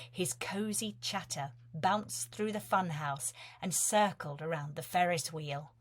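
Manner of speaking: ordinary delivery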